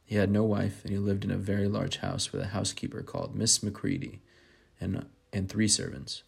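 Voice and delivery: ordinary talk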